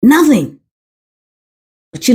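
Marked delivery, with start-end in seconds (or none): 0.0-0.6 "Nothing" punched high and firm; emphatic repeat. Real, not acted. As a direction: slam "Nothing" as a final word.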